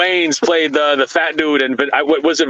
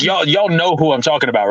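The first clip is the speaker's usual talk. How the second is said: normal talking voice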